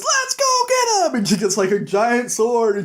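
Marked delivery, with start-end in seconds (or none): 0.0-1.2 rally the troops in a mock yell; mock rallying cry. Snow rallies a bunch of people.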